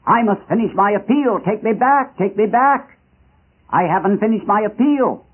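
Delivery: put-on high, insistent plea of an old warrior; acted urgent exclamation; exclaiming. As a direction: plead urgently to be taken back, pitch up. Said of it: "exclaimed the old warrior".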